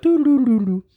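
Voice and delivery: high singsong doo doo doo; carefree humming. Retold or imitated. Humming to himself while cruising past the line. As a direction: hum along without a care. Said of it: "I'm just like".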